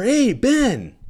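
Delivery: high, loud call from above; mock neighbor calling from above. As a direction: call down from the balcony upstairs. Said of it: "and I hear".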